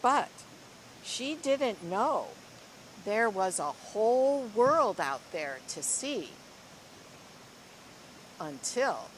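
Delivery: lively, expressive storybook reading; reading to children. Acted. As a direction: read it like a picture book to a class. Teacher reads picture book aloud.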